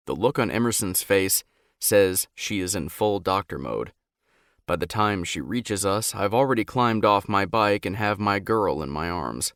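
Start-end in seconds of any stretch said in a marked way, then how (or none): none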